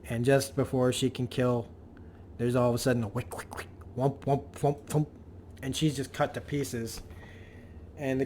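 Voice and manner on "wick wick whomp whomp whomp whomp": mouth-made swishes turning into dull whomps; imitated slicing noises. Storyteller imitating the Sentinel being cut to pieces, punchy sound effects. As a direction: voice the blade cuts as quick sound effects.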